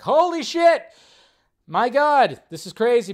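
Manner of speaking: fan freakout. Retold or imitated. Host acting out fans seeing Ahsoka and Luke, loud excited yell. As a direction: shout like a fan losing it.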